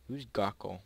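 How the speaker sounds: normal talking voice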